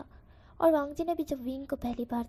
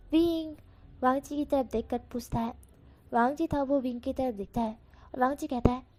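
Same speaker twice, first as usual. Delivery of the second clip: ordinary delivery, nothing marked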